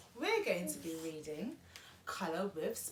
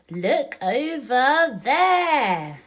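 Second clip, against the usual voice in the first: slow, louder, altered rabbit voice; grey rabbit voice; 6 semitones higher, 12 dB louder, much slower than usual. Acted. Mom voicing the grey rabbit for her daughter, slow and loud. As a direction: point the way, slow and secretive, as a small rabbit.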